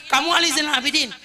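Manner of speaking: high, loud taunting; mocking insult. Insulter mocking Ali to his face, taunting. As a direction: sneer the name at him.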